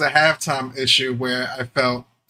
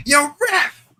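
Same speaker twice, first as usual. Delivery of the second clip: high call across the field; calling to ref; 11 semitones higher than usual. Retold or imitated. Calling out to the referee.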